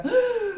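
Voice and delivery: sharp, high gasp of panic; panicked gasp. Retold or imitated. Retelling her panic, she gasps sharply. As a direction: suck in air in alarm. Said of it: "I'm panicking".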